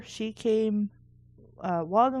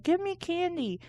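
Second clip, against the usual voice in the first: mock trick-or-treater demand; 8 semitones higher than usual. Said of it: "be like".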